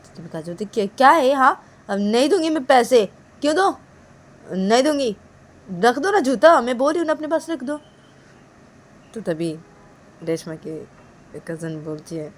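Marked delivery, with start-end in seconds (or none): 0.6-7.8 raised-pitch huffy refusal; defiant refusal. Acted. Kajal refusing to pay the cousins, defiant. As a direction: refuse crossly, voice lifted.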